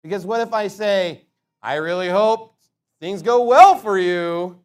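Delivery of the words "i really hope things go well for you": slow, loud, dripping with sarcasm; mock the well-wish, drawn out and louder; sarcastic. Shown as a demonstration. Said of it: "but I'm being sarcastic about it".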